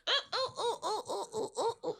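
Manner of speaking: soft, high string of monkey oohs; monkey hoots. Acted. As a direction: hoot softly like a monkey answering. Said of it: "And the monkey goes".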